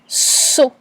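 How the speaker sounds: "saut" enunciated for spelling; dictated syllable. Shown as a demonstration. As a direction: dictate "saut" with a long hissed onset.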